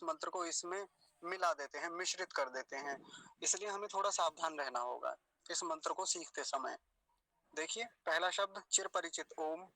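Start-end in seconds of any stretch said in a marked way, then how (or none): none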